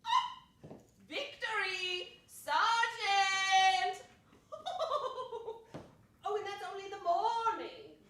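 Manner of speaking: loud, high, laughing victory shout; triumphant cry. Acted. Old lady crowing at the sergeant, loud triumphant laughter. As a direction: crow over the sergeant in triumph.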